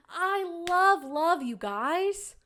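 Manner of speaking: slow, loud and high; swooning. Real, not acted. Gushing to viewers about a romance show, swooning. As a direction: draw it out, swooning.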